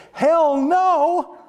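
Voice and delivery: high, loud laughing blurt of refusal; mock outburst. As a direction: blurt it out like a joke you'd never really say. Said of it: "a part of me that wanted to say".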